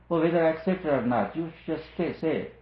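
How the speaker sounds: hammed-up brush-off, pushing back at the guru; defiant disciple quote. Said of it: "That's not the way to accept the truth".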